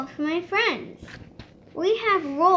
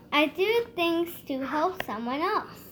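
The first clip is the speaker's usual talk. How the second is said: usual speaking voice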